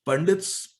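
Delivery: ordinary delivery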